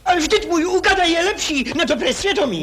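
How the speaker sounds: high, loud protest; heated high-pitched claim; indignant insistence. Acted.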